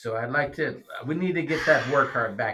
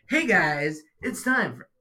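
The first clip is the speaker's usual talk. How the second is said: lifted, higher-pitched upbeat video greeting; more energetic; 5 semitones higher, 4 dB louder, slower than usual. Shown as a demonstration. Dad showing son a livelier intro, more energetic. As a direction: give the intro some pep. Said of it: "little more energetic and be like".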